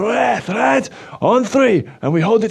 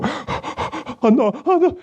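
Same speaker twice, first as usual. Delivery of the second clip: panicked; 6 semitones higher, much slower than usual. Acted. Puppet realizes no Mother's Day gift.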